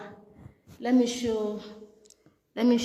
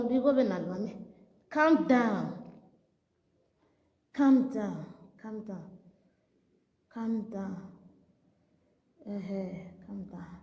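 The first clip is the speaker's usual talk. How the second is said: ordinary talk, nothing marked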